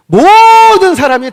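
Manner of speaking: loud, high swell on 모든; emphatic preaching. Real, not acted. Preacher stressing everyone heard the king, loudly. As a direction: stretch and belt 모든, then settle.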